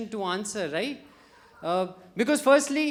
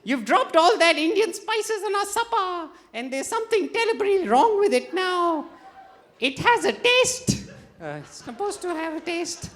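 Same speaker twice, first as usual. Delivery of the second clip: high-pitched, hammed-up English accent; mock English accent; 9 semitones higher, 4 dB louder than usual. English diner complaining of spices in supper, mock English accent. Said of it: "this is my English accent".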